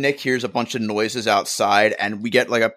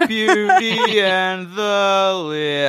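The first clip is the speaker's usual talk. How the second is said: slow drawn-out voice; 6 semitones higher, much slower than usual. Acted. Dragging out the title.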